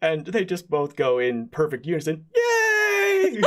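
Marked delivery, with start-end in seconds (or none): 2.3-3.4 very high shrieking yay; goblin cheer. Acted. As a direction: squeal it with joy. Two goblins cheer in unison.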